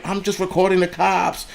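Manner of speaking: high, whiny-pitched imitation without real volume; mock his screechy protest, high up; high-pitched mock scream. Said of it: "his voice went high-pitched".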